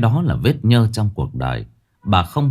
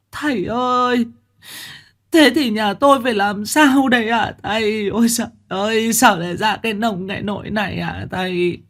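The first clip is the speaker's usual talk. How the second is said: put-on high, wailing old woman's voice; bà cụ khóc lóc; 13 semitones higher than usual. A narrator's reading. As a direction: wail desperately at the master. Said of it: "Bà Tháo khóc giống". (giọng bà lão cao, than khóc)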